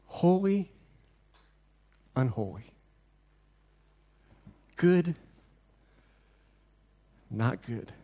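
first word up, its negative dropped low and soft; deliberate pairs with long pauses between; stark contrast. Shown as a demonstration. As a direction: let each "not" fall far below its opposite. Repeats God and man contrast in pairs.

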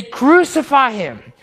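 slow, loud, heavy chant; the crowd demanding death from Pilate, slow and loud; crowd shout. Acted. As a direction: bellow it like an angry mob.

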